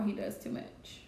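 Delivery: whispering under the breath; whispered aside. Real, not acted. Aside about pet bird.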